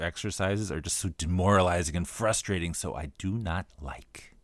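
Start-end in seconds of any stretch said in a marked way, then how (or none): 2.7-4.3 soft, slow whispered aside; whispering. Real, not acted. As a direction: drop to a whisper. Ends rant with a quiet aside.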